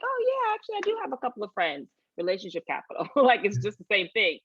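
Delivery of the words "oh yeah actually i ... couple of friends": eager reply. Retold or imitated. Voices an eager employee replying.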